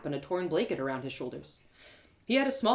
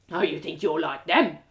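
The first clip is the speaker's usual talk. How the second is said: gruff guard; 3 semitones lower, 5 dB louder than usual. Acted. Castle guard lectures narrator about beggars, scornful. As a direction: sneer like a bossy castle guard.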